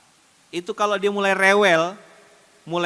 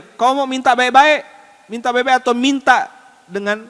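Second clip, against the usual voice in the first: loud, raised-pitch parental ultimatum; emphatic raised voice; parent laying down terms; 8 semitones higher, 9 dB louder than usual. Acted. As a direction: firmly lay down the rule to a child, loud and high. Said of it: "Bilang sama dia".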